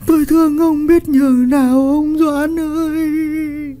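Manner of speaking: wailing lament. A narrator's reading. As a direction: wail the dead husband's name in grief. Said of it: "gào lên theo cái quan tài". (vợ khóc gào thương chồng, giọng than cao vút)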